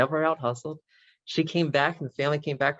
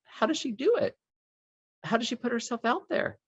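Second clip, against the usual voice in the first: put-on young girl voice; 11 semitones higher than usual. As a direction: voice the ten-year-old, much higher. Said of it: "she goes".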